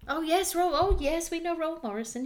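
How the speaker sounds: high, loud fake-cheerful islander; high mock villager voice. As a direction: go high and bright with fake welcome. Retelling villagers' fake friendliness.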